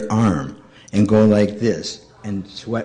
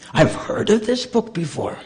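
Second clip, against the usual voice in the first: put-on European accent; 12 semitones higher, 4 dB quieter than usual. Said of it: "She was from foreign country".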